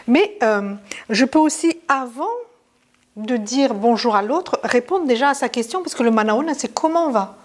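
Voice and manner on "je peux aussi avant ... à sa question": normal talking voice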